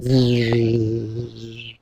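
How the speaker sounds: quiet buzzing fading away; soft low buzz. Acted. Bee flying off, soft buzz. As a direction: let the buzz drift off.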